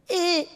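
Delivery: short high-pitched squeak; tiny strained "eh" up high; imitated squeezed squeal. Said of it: "then he'd make a sound".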